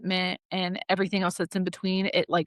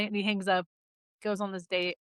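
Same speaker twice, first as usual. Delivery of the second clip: usual speaking voice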